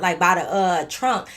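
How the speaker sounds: ordinary delivery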